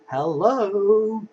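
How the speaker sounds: sudden high, loud greeting; surprised hello. Real, not acted. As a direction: blurt it out in surprise. Sudden exclamation mid card list.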